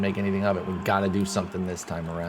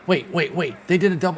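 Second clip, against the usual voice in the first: louder and higher, sudden alarm; alarmed surprise; 7 semitones higher, 4 dB louder than usual. Real, not acted. Fan seeing the opponent pull a double steal, alarmed. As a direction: catch the double steal as it happens.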